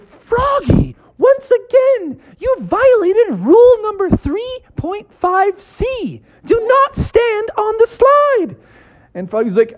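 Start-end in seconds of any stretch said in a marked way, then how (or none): 0.0-8.5 very high, slow rule-citing lifeguard; stern lifeguard. Acted. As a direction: recite the rule slowly and sternly. Lifeguard lays down rules.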